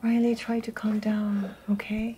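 hushed soothing whisper. Acted. Mother whispers to her crying daughter, soothing. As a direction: whisper softly to calm her.

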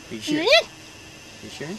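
normal talking voice

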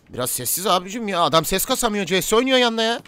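loud, high-pitched protest; exasperated complaint. Acted. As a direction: raise pitch and volume as you complain. Complaining about noisy customers.

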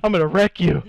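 loud aggressive yell; angry yell. Real, not acted. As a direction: yell it at the enemy. Attacking the enemy.

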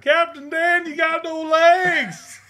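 high, loud movie-character holler; Captain Dan impression. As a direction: drag out the last words in a loud, high cry. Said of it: "That's a good impression".

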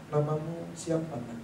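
put-on soft, slow question to a child; hushed, slightly low question. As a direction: drop your voice and ask it slowly. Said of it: "Suaranya saya turunin".

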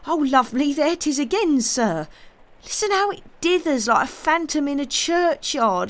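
high, loud rustic servant crying out in fear; panicked country lad pointing at a ghostly sound; frightened servant hearing a ghost; terrified servant. Acted. Servant hears ghost noise.

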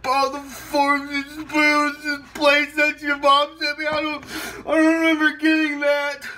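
high-pitched, hammed-up crying whine; tearful wailing. Host hurt by caller's words, high tearful wail. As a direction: blubber it high, as if deeply hurt.

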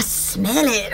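slow, low mock announcer "smell it"; radio announcer voice. Pitching a scratch and sniff commercial, slow mock announcer. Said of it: "I sound like a radio announcer".

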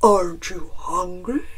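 low bear voice asking; inquiring bear. A narrator's reading. Bear offers Chicken lunch, low questioning bear. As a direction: ask it as a big friendly bear. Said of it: "Bear explained".

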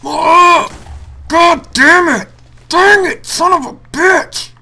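very high, very loud, slow raging scream; furious screaming. Real, not acted. Gamer raging after dying again, furious scream. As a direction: explode with rage at the game.

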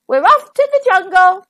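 high, loud, eager call; rallying storybook cry; adventurous announcement. A narrator's reading. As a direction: announce the departure with gusto. Door sets off on adventure.

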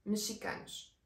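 "Mexicanos" whispered, with the x said as "sh". Shown as a demonstration. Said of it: "la X suena como una ch".

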